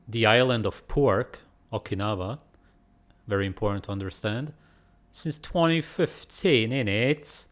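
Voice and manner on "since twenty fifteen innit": slow mock British read, tag on the innit; put-on British accent. Reading the label in a mock British accent. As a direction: play a cheeky Londoner. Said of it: "Should be talking British, innit?".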